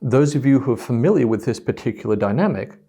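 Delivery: ordinary delivery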